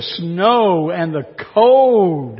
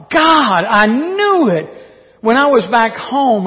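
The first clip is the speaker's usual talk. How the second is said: hammed-up yell at God, higher and louder; 5 semitones higher, 4 dB louder than usual. Jonah yelling at God, hammed-up angry outcry. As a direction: throw the accusation upward. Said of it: "He yelled at God".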